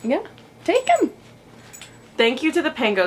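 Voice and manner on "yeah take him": pet talk. Real, not acted. Hands toy to dog.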